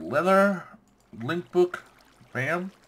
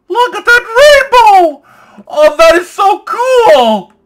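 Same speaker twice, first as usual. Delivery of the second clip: very loud, high shriek of awe; ecstatic squealing; 19 dB louder, slower than usual. Real, not acted. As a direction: squeal with delight, drawing out the last word. Thrilled reaction to the rainbow.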